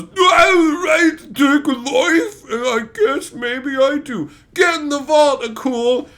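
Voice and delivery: loud, high mock-heroic declaration; hammed-up dramatic hero. Mocking Callan's line, hammed-up heroic shout. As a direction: overact the big heroic moment. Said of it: "And then Callan goes".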